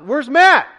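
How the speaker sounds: high, loud call; reenacted urgent shout; blurted question. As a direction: blurt it out across a parking lot. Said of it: "I'm like, first thing out of my mouth".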